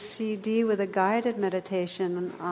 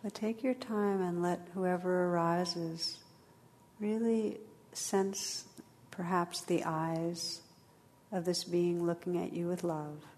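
guided meditation; 3 dB quieter than usual. Acted. Leads a meditation.

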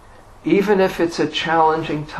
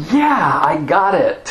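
higher-pitched triumphant cheer; mock elation; 4 semitones higher, 3 dB louder than usual. Meditator on the up of a rollercoaster, mock elation. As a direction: act out a burst of triumph. Acting the highs of emotional rollercoaster.

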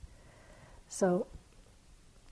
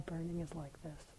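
much softer, just noting the burning; quiet noting; 4 semitones lower, 13 dB quieter than usual. Shown as a demonstration. Meditator quietly noting pain, soft and simple. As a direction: let "Burning is like this" drop to a soft, simple note. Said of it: "You know".

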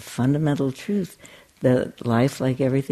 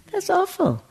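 dismayed. Real, not acted. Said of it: "the terrible phrase to use".

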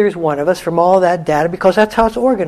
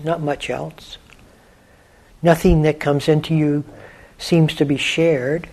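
softer meditation voice with long pauses; guided meditation; 5 dB quieter than usual. Acted. Teacher guiding listeners to let the quiet in, unhurried meditation voice. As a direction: guide listeners gently inward, leaving long silences.

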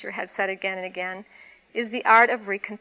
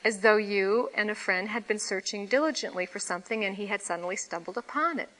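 ordinary delivery, nothing marked

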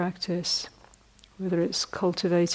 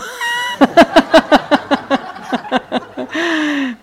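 big laugh; 9 semitones higher, 10 dB louder than usual. Real, not acted. Laughing at own punchline.

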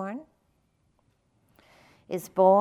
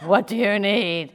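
loud, booming divine question; God voice; 7 dB louder than usual. Acted. God asking the man on the cliff, booming voice. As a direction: boom it out grandly from above. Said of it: "I have to put on my God voice".

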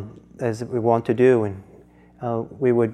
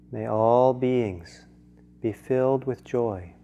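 prayerful blessing; slower than usual. Acted. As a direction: lay each blessing down slowly. Recites a loving-kindness blessing.

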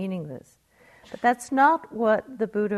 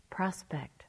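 whispered word; 2 semitones lower, 8 dB quieter than usual. Real, not acted. Teacher musing to listeners in a whisper.

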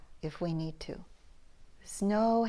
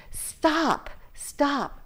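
mock yell, pitched higher; a hint of a yell, pitched up; retold yell; 7 semitones higher than usual. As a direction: reenact the shout to stop a fight, higher. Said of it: "I yelled as loud as I could".

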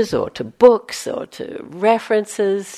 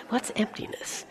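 whisper; 4 semitones higher, 10 dB quieter than usual. Acted. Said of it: "unvoiced whisper aside".

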